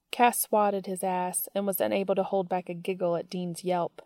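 ordinary delivery, nothing marked

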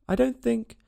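high, flustered start; confused. A narrator's reading. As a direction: begin uncertainly, confused. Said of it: "very much confused".

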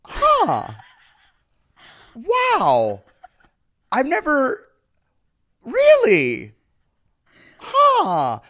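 high, loud baffled reactions; drawn-out huhs and wows, high and loud; mock puzzled surprise. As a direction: play the stunned out-of-stater. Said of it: "People are just like".

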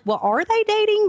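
high, puzzled question; a sudden confused jump up; surprised. Retold or imitated. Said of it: "this is at the point where I was like".

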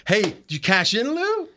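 cheeky call lifting sharply on the name; Ted needling Lou. Retold or imitated. Ted asks losing Lou about cashing in, needling. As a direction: imitate a gloating bettor, voice leaping up at the end. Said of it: "You know, like that".

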